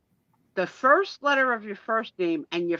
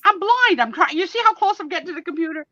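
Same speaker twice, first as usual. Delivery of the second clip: flustered and excited; 10 semitones higher, 6 dB louder than usual. Real, not acted. Struggling to read the screen.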